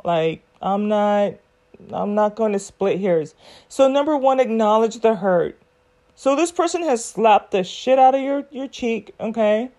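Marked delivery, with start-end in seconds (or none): none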